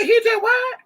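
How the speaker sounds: very high, loud incredulous outburst; imitated mama in high-pitched disbelief; mock outraged mother. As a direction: shriek it like an outraged mom. Retelling mother's outraged reaction.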